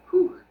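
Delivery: sharp blowing gasp at hot food. Real, not acted. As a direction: blow out from a burning bite. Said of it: "Hot, hot, hot".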